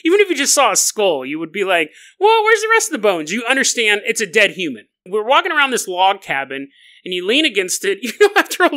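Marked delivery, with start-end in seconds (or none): 2.2-3.3 surprised. Pretend. Imagined reaction to skull.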